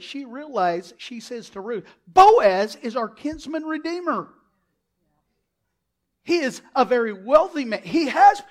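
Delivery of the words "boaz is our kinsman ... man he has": loud, high, emphatic announcement; excited proclamation. Acted. Naomi telling Ruth of the redeemer, excited proclamation. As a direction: proclaim it like breaking good news.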